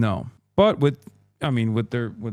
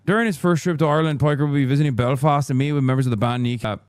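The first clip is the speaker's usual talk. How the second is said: normal talking voice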